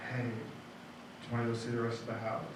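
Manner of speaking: soft breathy come-on, barely voiced; imitating a whisper. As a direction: murmur it like a secret invitation. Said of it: "I hear Tim whisper into his ear".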